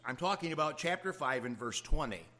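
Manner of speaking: ordinary delivery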